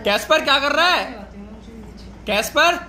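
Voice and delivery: high-pitched scolding call. Real, not acted. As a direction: call out sharply, pitched well up. Calling out to Casper to stop. (ऊँची आवाज़ में टोकते हुए पुकारना)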